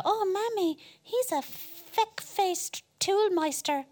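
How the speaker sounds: put-on high, slow little kid voice; child voice. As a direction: play the innocent child repeating a swear. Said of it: "they go".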